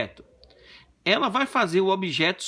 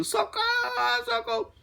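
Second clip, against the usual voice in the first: very high falsetto mock cries for help; desperate cries. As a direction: shriek like a panicked wife. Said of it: "naquele desespero". (gritos de desespero em falsete)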